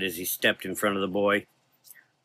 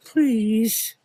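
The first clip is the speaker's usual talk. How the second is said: tearful plea; 13 semitones higher, 4 dB louder than usual. Acted. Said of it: "The child quietly cried".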